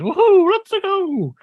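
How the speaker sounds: very high, stretched-out cartoon cheer; Mario voice. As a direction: belt it like a cartoon plumber. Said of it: "turns into Charles Martinet Mario".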